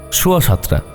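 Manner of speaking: usual speaking voice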